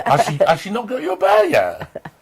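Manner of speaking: normal talking voice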